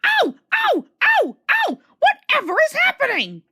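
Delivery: very high, loud squealing cries; squealing trucks. A narrator's reading. Bumped trucks screaming at each other in high squeals. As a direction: squeal like toy trucks in pain.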